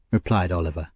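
ordinary delivery, nothing marked

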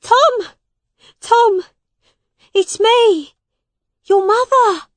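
high, loud shouts for a son; desperate calling. A narrator's reading. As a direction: call out frantically so he knows you. Mother calling out to her son.

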